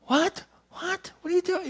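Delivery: high mock-incredulous kid voices; stunned kids. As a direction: repeat it in disbelief, high-pitched.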